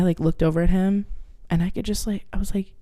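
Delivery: ordinary talk, nothing marked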